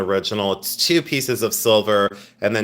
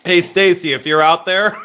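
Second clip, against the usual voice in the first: louder call to Stacy; 5 semitones higher, 5 dB louder than usual. Real, not acted. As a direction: call out to her. Calling out to a viewer.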